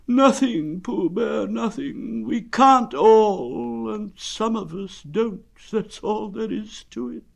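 high, drawn-out resignation; slow donkey voice, pitched high and resigned; gloomy donkey. Acted. Donkey telling Pooh that nothing is wrong, gloomy.

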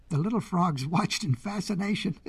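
ordinary delivery, nothing marked

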